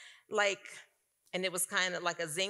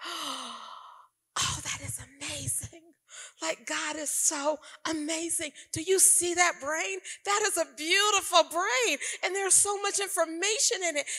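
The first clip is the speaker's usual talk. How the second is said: higher, airy voice full of wonder; marveling awe; 9 semitones higher, slower than usual. Retold or imitated. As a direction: gaze at it like a miracle. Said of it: "And I'm marveling".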